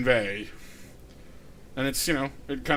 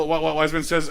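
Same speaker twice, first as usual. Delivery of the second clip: ordinary talk, nothing marked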